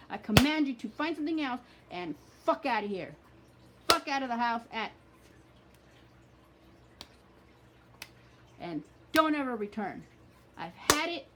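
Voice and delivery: loud furious commands to leave; shouting an unwanted presence out the door; angry banishing. Shown as a demonstration. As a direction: throw them out of your life with full anger. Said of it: "anger, with feeling".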